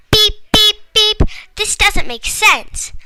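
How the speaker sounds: high, loud robotic beeping, then slow; shrill beeps slowing into a drawled complaint; mind beeping. Acted. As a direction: beep like a confused robot brain. Reader's mind glitching like robot.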